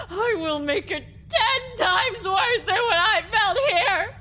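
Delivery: sobbing, vengeful outburst; tearful fury. Acted. Victim swears revenge on her torturer, crying with rage. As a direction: spit out threats through crying.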